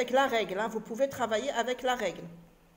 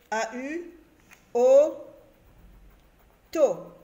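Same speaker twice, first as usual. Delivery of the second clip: "O, to" very loud, with a long gap between syllables; syllable-by-syllable reading; 7 dB louder, slower than usual. Shown as a demonstration. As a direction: call out each syllable separately. Składa słowo auto z sylab.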